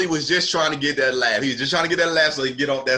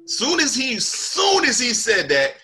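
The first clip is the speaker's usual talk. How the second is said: normal talking voice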